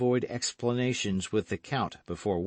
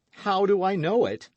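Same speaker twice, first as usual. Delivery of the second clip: indignant echo; 11 semitones higher, 6 dB louder than usual. Acted.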